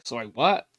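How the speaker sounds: usual speaking voice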